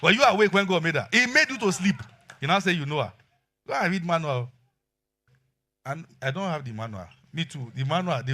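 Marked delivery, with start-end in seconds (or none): 0.0-4.5 loud preaching challenge; loud sermon challenge. Acted. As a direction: drive it home to the men, loud. Preaching climax to congregation.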